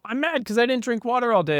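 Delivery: dad mimicking his kid, whiny and high; mock whiny kid. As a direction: whine it like a thirsty kid complaining.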